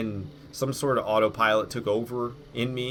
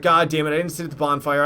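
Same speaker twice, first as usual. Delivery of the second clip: retold curse; 5 semitones higher, 7 dB louder than usual. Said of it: "I was like".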